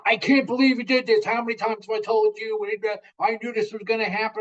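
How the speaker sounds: high, scolding rant of a fed-up dad; mock angry parent. Dad scolding son after the crash, high and exasperated.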